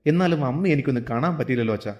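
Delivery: ordinary delivery, nothing marked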